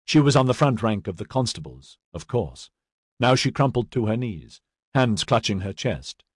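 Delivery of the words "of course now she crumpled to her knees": plain reading voice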